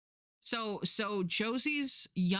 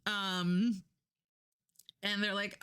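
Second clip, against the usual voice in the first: ordinary delivery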